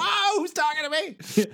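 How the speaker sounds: hammed-up startled teen, laughing through it; panicked Jack. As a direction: freak out at a talking bike. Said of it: "And he's like".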